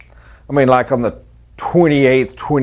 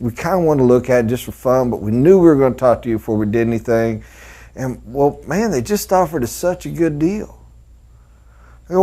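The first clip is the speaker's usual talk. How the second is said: normal talking voice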